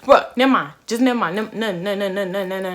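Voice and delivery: loud, irritated dismissal; mad, imitated. Imitating her date brushing off her "huh", mad. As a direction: cut her off crossly, repeating and tumbling over it. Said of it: "he get mad".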